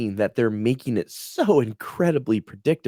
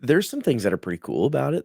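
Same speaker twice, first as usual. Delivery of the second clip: normal talking voice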